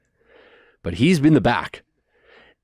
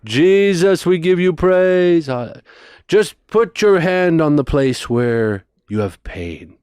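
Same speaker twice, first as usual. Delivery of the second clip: high, louder, slow prayer call; imitated healing preacher; 6 semitones higher, 3 dB louder, slower than usual. Preacher uncle leading a healing prayer. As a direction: guide them to touch their pain. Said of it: "he's like".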